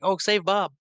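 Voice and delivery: high-pitched imitation of worried onlookers; hammed-up cry to the rescue; mock alarm. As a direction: pitch leaps up in pretend concern. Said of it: "suddenly now extremely invested".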